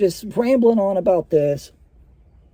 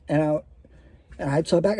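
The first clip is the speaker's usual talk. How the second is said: ordinary talk, nothing marked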